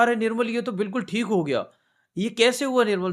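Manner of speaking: high, excited disbelief; amazed sister. Retold or imitated. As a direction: gasp it out like a miracle just happened. Sister amazed her son is healed.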